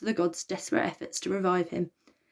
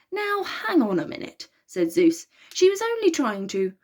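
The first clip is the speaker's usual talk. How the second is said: protest; 9 semitones higher, 3 dB louder than usual. A narrator's reading. Zeus objects to council.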